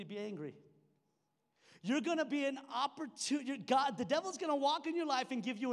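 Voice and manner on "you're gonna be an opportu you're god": ordinary delivery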